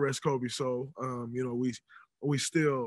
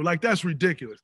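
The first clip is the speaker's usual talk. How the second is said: ordinary talk, nothing marked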